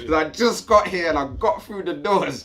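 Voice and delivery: high, loud warning to behave; urgent scolding. Retold or imitated. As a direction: hiss it at him like a desperate order. Scolding drunk fellow prisoner.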